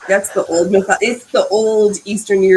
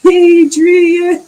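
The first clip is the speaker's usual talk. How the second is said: Rocky-style shout; 9 semitones higher, 8 dB louder than usual. Pretend. Rocky reference, calling Adrian.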